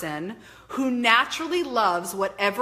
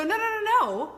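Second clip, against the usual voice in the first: higher-pitched string of quick no's; insistent self-talk protest; firm refusal; 11 semitones higher than usual. Acted. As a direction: shut the thought down, high and firm. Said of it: "take that power back to go".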